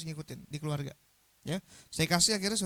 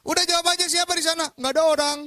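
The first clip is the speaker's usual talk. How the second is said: high, loud mock yelling; hammed-up shouting match with the teacher; shouted argument; 10 semitones higher, 10 dB louder than usual. Guru dan murid saling berteriak. (adu teriak yang dilebih-lebihkan)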